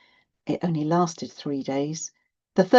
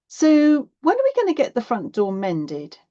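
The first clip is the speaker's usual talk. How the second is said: higher, louder challenge to mum; pointed, pitched-up question; demanding child; 8 semitones higher, 5 dB louder than usual. A narrator's reading. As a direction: press mum for an answer.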